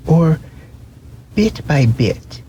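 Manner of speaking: high, timid little rabbit; wide-eyed velveteen rabbit asking; small rabbit voice. Acted. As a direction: ask it like a shy toy rabbit. Rabbit voice, higher.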